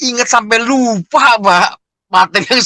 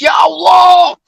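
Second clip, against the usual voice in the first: crying out to God; 9 semitones higher, 4 dB louder, slower than usual. Retold or imitated. Crying out in prayer.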